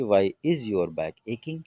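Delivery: slow English example for the class; slow model sentence. Shown as a demonstration. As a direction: dictate it word by word. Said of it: "क्योंकि English पहले आ जाएगी". (धीरे बोला गया उदाहरण)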